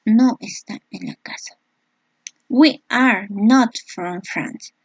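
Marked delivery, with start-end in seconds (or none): 2.5-4.7 slow, careful English example read out; slow model sentence. Shown as a demonstration. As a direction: spell it out slowly for the class. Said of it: "teacher reading example slowly". (lectura lenta y clara del ejemplo)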